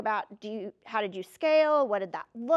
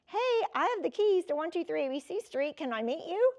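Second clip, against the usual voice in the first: upbeat, higher sales-call tone; bright phone voice; 5 semitones higher than usual. Retold or imitated. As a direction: sound keen and friendly on the phone. Calling the banks cheerfully.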